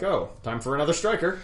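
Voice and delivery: normal talking voice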